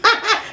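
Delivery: short, high burst of laughter; quick high giggle. Real, not acted. Telling how twin predicted her tears, short high laugh.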